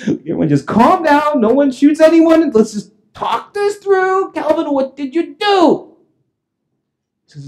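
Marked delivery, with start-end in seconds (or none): 0.1-4.3 high-pitched, anxious peacemaking; frantic plea. Retold or imitated. Homeowner defusing armed police, high frantic plea. 4.3-5.9 high, slow, drawn-out question; panicked demand. Retold or imitated. Homeowner to Calvin as police panic, high drawn-out demand.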